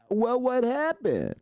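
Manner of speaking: high, slow whiny question; hammed-up pitch jump on what; mock confused latecomer. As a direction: play the clueless guy who missed out. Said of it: "and be like".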